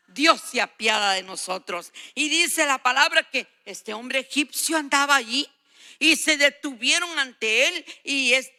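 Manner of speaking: ordinary delivery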